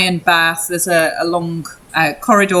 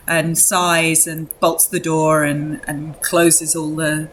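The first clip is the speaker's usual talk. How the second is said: ordinary delivery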